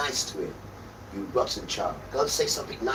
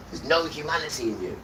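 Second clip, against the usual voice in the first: ordinary delivery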